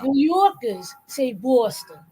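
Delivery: slow, Yorkers and Boston with rounded "aw"; New York pronunciation. Shown as a demonstration. Teaching the difference, New Yorkers and Boston with "aw". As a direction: draw out "Yaw-kuhz" and "BAW-ston". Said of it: "you see how I said New Yorkers?".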